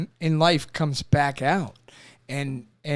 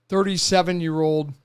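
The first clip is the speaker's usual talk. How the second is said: ordinary delivery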